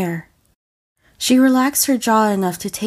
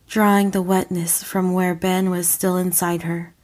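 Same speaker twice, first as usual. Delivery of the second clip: ordinary delivery, nothing marked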